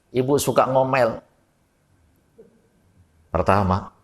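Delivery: ordinary delivery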